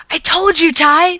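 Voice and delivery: high, loud gloating shout; yelled. Acted. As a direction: crow it across the street. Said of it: "Jason yelled".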